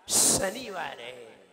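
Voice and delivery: slow शनिवार with a long hissed श, falling low; whistled श. Shown as a demonstration. Teacher drills today's day, long whistling श in शनिवार. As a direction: stretch the opening sh of शनिवार. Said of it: "सही बोलिए".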